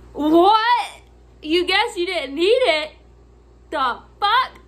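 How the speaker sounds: loud, high disbelief; incredulous outburst. Retold or imitated. Clerk mocking lady who didn't need dresser, loud disbelief. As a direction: explode in baffled outrage.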